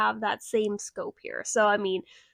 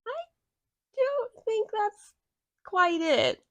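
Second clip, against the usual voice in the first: higher, slow, skeptical tone; doubting the accent, higher and slow; mock doubtful inner voice; 9 semitones higher, slower than usual. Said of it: "I'm like".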